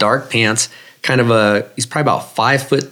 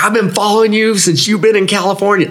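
excited; 10 semitones higher than usual. Retold or imitated. Veteran recognizing the runner, high excited exclamation. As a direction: burst out with recognition.

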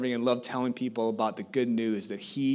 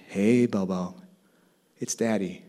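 tender greeting; 5 semitones lower than usual. Retold or imitated. Father greeting newborn daughter.

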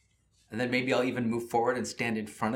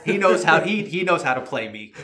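amused laughing complaint; 7 semitones higher, 13 dB louder than usual. Real, not acted. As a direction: laugh through the words. Laughing at villain.

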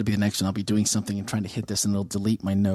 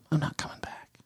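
breathy whispered aside; 3 semitones higher, 6 dB quieter than usual. Real, not acted. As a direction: drop to a whisper.

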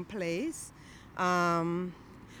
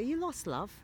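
higher-pitched, botched attempt at Yorkshire; bad Yorkshire accent; 5 semitones higher than usual. Passer-by asking a lost newcomer, bad Yorkshire accent. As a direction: imitate a Yorkshire local, badly. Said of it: "I can't do a Yorkshire accent. That was terrible".

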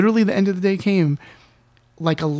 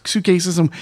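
plain reading voice

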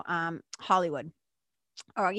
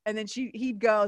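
ordinary talk, nothing marked

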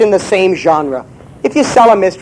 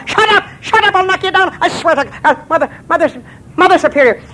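much higher, tiny flustered voice; a little man caught out, voice jumping way up; squeaky leprechaun; 11 semitones higher than usual. Acted.

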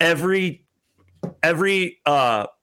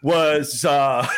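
usual speaking voice

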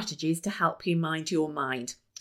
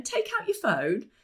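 normal talking voice

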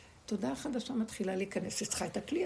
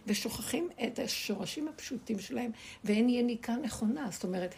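usual speaking voice

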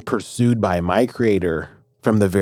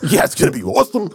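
higher, fast, hyped buddy voice; excited dude God; 11 semitones higher, faster than usual. Pretend. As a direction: cheer it like a stoked friend. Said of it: "You're like".

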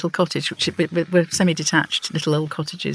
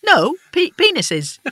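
put-on high, emphatic old lady; emphatic old woman; 12 semitones higher, 4 dB louder, slower than usual. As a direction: insist it like a stubborn neighbour. Wyn insisting hard.

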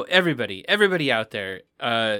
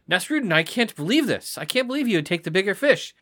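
shocked philosopher; 8 semitones higher than usual. Acted. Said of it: "the philosopher is just shocked".

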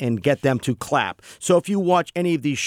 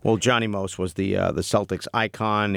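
ordinary talk

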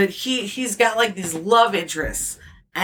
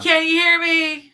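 louder and higher, projecting to the back row; 8 semitones higher, 5 dB louder than usual. Pretend. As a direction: throw the voice out so everyone hears. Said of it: "I will start projecting".

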